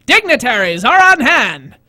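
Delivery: slow, loud 1930s newsreel announcer; old-timey voice. Acted. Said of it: "I love doing things in old-timey voice".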